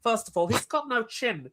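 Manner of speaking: Chris Eubank impression. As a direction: break it down like a pompous English pundit. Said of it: "I'm gonna do a weird voice".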